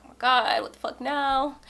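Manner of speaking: hammed-up, loud fed-up reaction; mock exasperation. Boyfriend answering yet another sit-down talk, mock exasperated.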